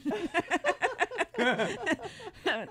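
high, loud burst of laughing; helpless laughter. Real, not acted. Host sounding like the callers, cracking up laughing. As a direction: crack up at your own mistake.

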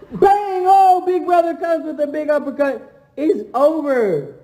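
high hyped commentator call; mock ring announcer. Big brother calling his win over little brother, hyped. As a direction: call the knockout like a ringside announcer.

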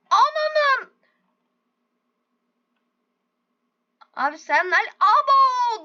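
high, loud shocked whine; shocked cry. Real, not acted. Gamer shocked at the goat's fall, crying out. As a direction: cry out in alarm.

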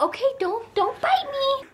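high, soft, timid plea; mock-meek baby-talk voice; gentle request. Shown as a demonstration. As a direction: ask sweetly, small and high. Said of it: "it's not like a".

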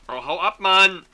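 loud high shoo; angry shout. Real, not acted. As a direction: yell at it to go away. Chasing thief creature off.

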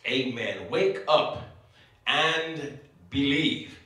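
normal talking voice

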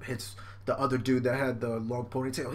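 normal talking voice

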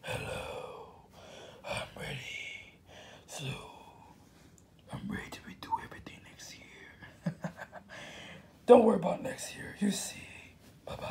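unvoiced secretive whisper; whispered character voice. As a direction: breathe it like a secret.

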